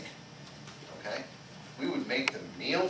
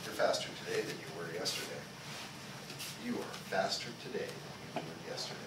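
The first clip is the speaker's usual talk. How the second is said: low, soft words to himself; quiet self-talk; 7 semitones lower, 9 dB quieter, faster than usual. Retold or imitated. Instructor voicing a fighter's quiet self-talk. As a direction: murmur it as inner self-talk. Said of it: "he tells himself things like".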